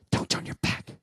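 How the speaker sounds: quick, forceful whisper; ghostly whisper. Retold or imitated. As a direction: hiss it suddenly into an ear. Ghostly voice in his ear.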